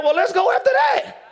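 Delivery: high, loud mock enthusiasm; eager believer. Believers wanting to follow the world, high loud mock eagerness. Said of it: "believer's gonna be like".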